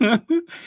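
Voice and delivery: high-pitched nervous giggle; embarrassed laugh. Real, not acted. Host trying to apologize to a viewer, embarrassed laughing. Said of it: "I am so embarrassed".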